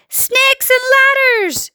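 very high, stretched exclamation; horrified yell. A narrator's reading. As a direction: cry out in shock, dragging the words. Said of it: "yelled the head of the fire".

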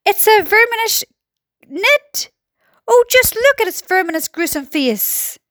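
high, halting exclamation; high-pitched storybook alarm; horrified cry. A narrator's reading. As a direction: cry out in disgust and horror. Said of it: "cried the chief of police".

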